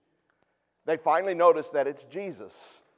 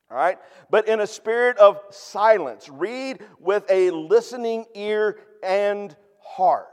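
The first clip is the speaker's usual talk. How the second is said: raised, slow and urgent, pressing each word; emphatic preaching; 5 semitones higher, 8 dB louder, slower than usual. Real, not acted. Pastor urging the congregation to savor Scripture, emphatic preaching. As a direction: drive the point home to the congregation.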